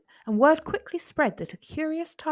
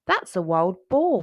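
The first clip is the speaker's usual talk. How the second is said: plain reading voice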